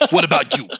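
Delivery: ordinary talk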